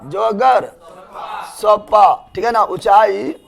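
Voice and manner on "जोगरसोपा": "जोगरसोपा" stretched very slowly, each syllable separate; very slow dictation. Shown as a demonstration. Teacher drilling the waterfall's old name, very slowly. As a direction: draw out "जोगरसोपा" very slowly so students memorise it. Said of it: "पुराना नाम".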